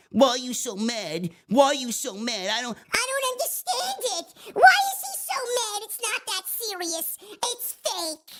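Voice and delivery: high, whiny mock voice of commenters. Mocking online commenters with a high whiny voice. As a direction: squeak it out like a pestering fan. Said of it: "This is the shit that I got".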